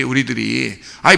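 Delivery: ordinary talk, nothing marked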